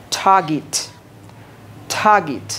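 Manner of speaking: target in British accent, aspirated T, no R; British target, taa-git; British English target. Shown as a demonstration. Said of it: "ব্রিটিশদের মতো বলবো".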